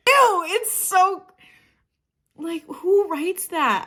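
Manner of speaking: slow, drawn-out disgust. Real, not acted. Reacting to the lyric with slow disgust. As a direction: drag it out, grossed out.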